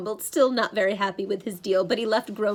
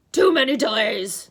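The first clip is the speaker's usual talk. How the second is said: loud monster complaint; monster bellow; 7 dB louder than usual. A narrator's reading. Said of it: "the monster bellowed".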